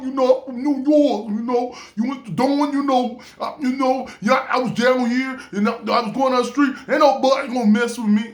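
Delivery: put-on an amateur's bold New York accent, picking up speed. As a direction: strut it out like a tough New Yorker, New York accent. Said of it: "I'm trying to do the New York".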